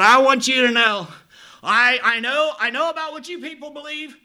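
high, loud, defiant cry; retold angry outburst. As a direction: throw it at them in anger. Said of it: "I am angry".

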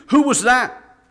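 high, loud, startled scream; a condemned lad shrieks at the jailer. Acted. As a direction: scream it out in shock. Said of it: "and screamed".

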